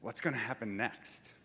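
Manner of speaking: quiet visionary question; hushed wonder. Retold or imitated. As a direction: ask it quietly, imagining the house. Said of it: "He looked at that house and said".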